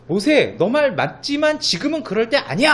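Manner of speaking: God firmly correcting Moses; forceful God. Retold or imitated. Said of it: "아주 강력하게 말씀하시는 거죠".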